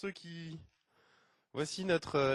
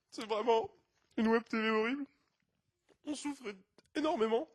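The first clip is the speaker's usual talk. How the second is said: high, hammed-up distress; mock desperate appeal; 7 semitones higher, 4 dB louder than usual. Presenter telling viewers how they suffer, theatrically desperate. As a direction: plead in exaggerated suffering. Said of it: "un appel désespéré".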